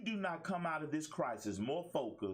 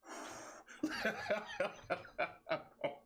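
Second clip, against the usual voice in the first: a quick chuckle with a falling finish; amused laugh; 5 semitones higher than usual. Real, not acted. Pausing over his coffee to laugh at his jab. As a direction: laugh at your own jab.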